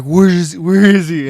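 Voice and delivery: loud, high mock calling out; imitated searching call. Friend retelling the search, imitated calling out. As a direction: throw it out as if hunting for someone.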